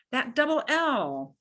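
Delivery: ordinary talk, nothing marked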